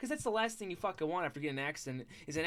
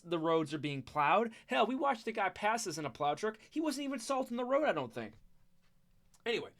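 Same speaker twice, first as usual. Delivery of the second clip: ordinary talk, nothing marked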